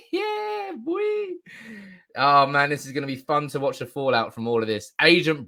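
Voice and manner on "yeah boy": falsetto. Real, not acted. Gleeful falsetto cheer.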